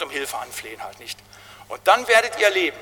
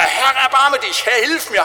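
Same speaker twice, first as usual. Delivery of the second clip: loud, high, slow desperate call; beggar's shout; 7 semitones higher, 5 dB louder, slower than usual. Retold or imitated. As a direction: call out to Jesus passing by. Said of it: "und dann schreit er". (lauter, flehender Schrei des Bettlers)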